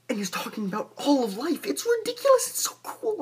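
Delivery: high-pitched, loud burst of excitement; gush, voice shooting way up; real giddy enthusiasm, pitch leaping; excited gushing. Real, not acted. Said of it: "I get excited about it".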